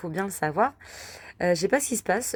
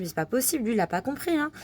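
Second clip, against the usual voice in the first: exasperated; 7 semitones higher than usual. Retold or imitated. Exasperated remark, man follows Patrice.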